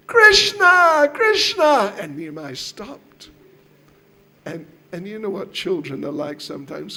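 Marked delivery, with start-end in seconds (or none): 0.1-1.9 high, very loud call; experimental calling out. Acted. Lady testing the name on crying Nimai, loud calling. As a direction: shout the name out to stop the crying. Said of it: "Let me, let me try again".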